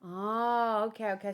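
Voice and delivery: ordinary delivery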